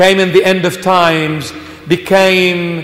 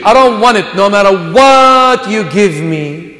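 Habibi proclaimed louder and higher, almost shouting; full-force preaching; 6 semitones higher, 4 dB louder than usual. Acted. Preacher defying Egypt and Satan, full-force preaching. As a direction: preach it out with fire, defiant.

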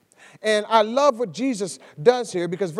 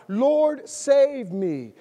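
cry for help; 6 semitones higher, 4 dB louder, much slower than usual. Retold or imitated. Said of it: "he cried out".

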